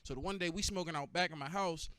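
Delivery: usual speaking voice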